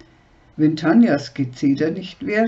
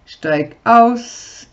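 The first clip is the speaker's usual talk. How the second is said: barked louder and higher; curt order; 6 semitones higher, 6 dB louder than usual. Acted. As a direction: order her out of the camper. Sascha orders her out.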